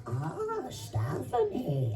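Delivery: put-on high-pitched elderly woman voice; Aunt Manya. Shown as a demonstration. Voice actor demonstrating a character voice, high elderly woman. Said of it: "I just became this elderly woman voice".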